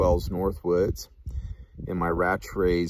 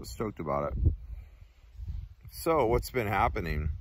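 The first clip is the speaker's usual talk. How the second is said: lips clenched around pipe stem; talking around a pipe; speaking with pipe in mouth; 2 semitones higher than usual. Real, not acted. Seen 1: Pipe stem held in lips through most words, removed near end.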